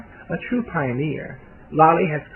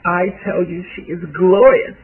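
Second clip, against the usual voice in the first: higher, louder, theatrical praise; gushing with flair; 5 semitones higher, 8 dB louder than usual. A narrator's reading. Young socialite praising a famous dancer, gushing with flair. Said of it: "gesturing in the air with flair".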